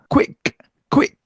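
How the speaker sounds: quick with oo-ee split, not 퀵; English quick. Shown as a demonstration. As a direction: glide quick from oo. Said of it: "퀵 아니고요".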